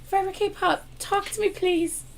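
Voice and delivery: pleading call. Real, not acted. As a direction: plead with the viewer to answer. Begging a commenter to reply.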